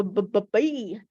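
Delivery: three short b, b, b; b sound. Shown as a demonstration. Teacher giving the first-sound hint. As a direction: bounce out b, b, b. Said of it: "It starts with a b".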